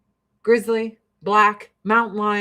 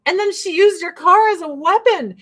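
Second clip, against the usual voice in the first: high, louder whining mock; mock Democrats; 8 semitones higher, 4 dB louder than usual. Mocking Democrats defending the driver, high whiny mock voice. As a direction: play it up as a naive defender. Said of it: "Democrats like".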